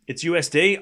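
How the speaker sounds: ordinary talk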